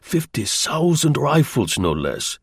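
impressive German agent. Acted. German agent selling his rifle story, slow and accented. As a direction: pronounce the figure slowly, with German accent.

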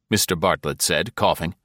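usual speaking voice

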